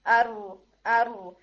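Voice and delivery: ارو said slowly with a single light trill; short rolled r. Shown as a demonstration. Teacher repeats ارو with only one or two taps. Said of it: "صرف once اور twice".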